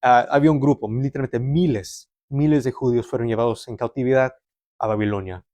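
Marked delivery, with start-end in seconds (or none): none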